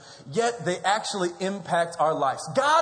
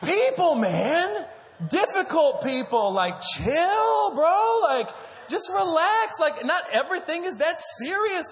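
exasperated shout; 11 semitones higher, slower than usual. Real, not acted. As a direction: beg them to just relax. Exasperated plea to chill.

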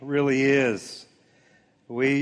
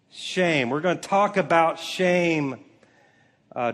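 usual speaking voice

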